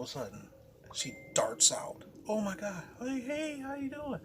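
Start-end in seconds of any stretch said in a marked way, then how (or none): 2.3-4.2 high, flustered, fake-cheery girlfriend; caught girlfriend. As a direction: chirp a guilty greeting, much higher.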